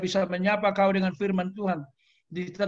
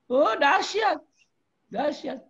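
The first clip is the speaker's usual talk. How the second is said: high, loud cry of amazement; awed exclamation; 8 semitones higher, 3 dB louder, slower than usual. Real, not acted. Preacher praising God's work, high awed cry. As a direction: let the wonder burst out.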